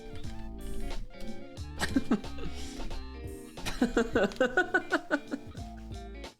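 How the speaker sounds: high staccato creature cackle, softly done; Stitch. Acted. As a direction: giggle like a little alien, in a rapid chatter. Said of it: "We'll do the Stitch laugh".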